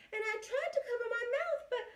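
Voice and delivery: winding up for a sneeze; building up to sneeze. Acted.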